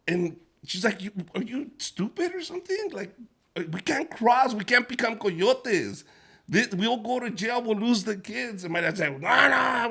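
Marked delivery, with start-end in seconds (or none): 1.0-8.6 higher, exasperated wife voice; mom scolding. Retold or imitated. Fed-up wife scolds husband about crossing, higher and exasperated. 9.2-9.9 put-on loud, high drunk refusal; dad shouting. Drunk dad shouts down his wife, loud and high.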